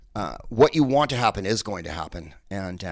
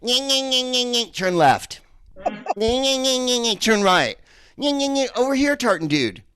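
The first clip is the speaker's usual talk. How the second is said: much higher nonsense nyeh babble; mock gibberish; 14 semitones higher, 3 dB louder than usual. Comic mocking how he sounds to Scots, high gibberish. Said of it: "I must just sound like this to them".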